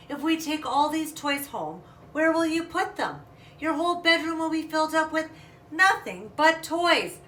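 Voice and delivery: mother losing patience, stretching the last words; exasperated mother. Acted. Mother warning her daughter about a room full of toys, exasperated.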